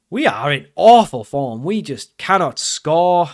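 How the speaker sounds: loud, slow, heavily leaned-on words; exasperated emphasis. Real, not acted. Player lamenting a lost final, exasperated. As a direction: drag out each word in frustration.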